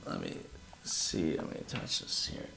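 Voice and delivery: whisper to self. Real, not acted. Muttering while fixing phone.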